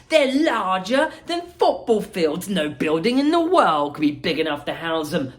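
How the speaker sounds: high, lively showman voice; bubbly chocolatier welcoming guests; Willy Wonka voice. Acted.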